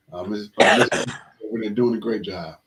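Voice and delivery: normal talking voice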